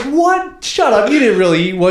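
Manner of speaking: loud, high, incredulous outburst; retold disbelief. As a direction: re-enact shocked disbelief at full volume. Retelling his disbelief at dad's confession.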